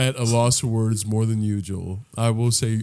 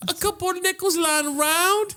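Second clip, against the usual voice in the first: high mocking squeak; mock ghost. Mocking the broke ghost in a high squeak. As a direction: echo him in a silly voice.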